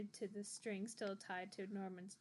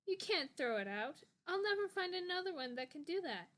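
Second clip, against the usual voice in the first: whining; 7 semitones higher, 4 dB louder than usual. A narrator's reading. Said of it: "Norman whined".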